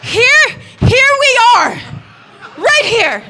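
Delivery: high, very loud calling across the room; excited shout. Retold or imitated. Brother volunteering himself and his sister, high drawn-out shouting. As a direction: holler to get the MC's attention, drawing it out.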